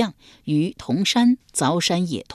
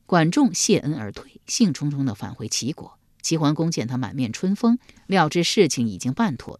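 ordinary delivery, nothing marked

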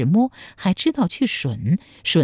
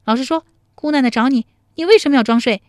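put-on scolding girl; 7 semitones higher, 5 dB louder, faster than usual. A narrator's reading. Tao Sanchun interrogating Zhang Qiong.

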